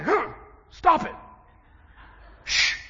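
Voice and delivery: high, loud retold "stop it" and a hiss of shh; mock stern shushing; sharp hush. Preacher retells hushing a prophetess.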